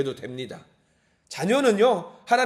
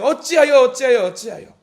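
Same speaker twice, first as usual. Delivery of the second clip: 어찌하여 cried out much higher and louder, then easing; anguished outcry; 5 semitones higher, 9 dB louder than usual. Acted. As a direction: wail 어찌하여 like a dying man's cry. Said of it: "욥도 이야기하잖아요". (어찌하여를 절규하듯 높고 크게)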